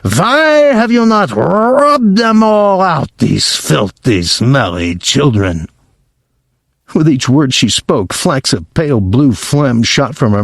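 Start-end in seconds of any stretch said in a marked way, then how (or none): 0.0-5.7 outraged witch venting at her followers; Grand High Witch scream. Acted. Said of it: "she screamed".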